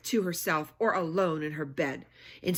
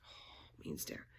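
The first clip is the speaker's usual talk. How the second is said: usual speaking voice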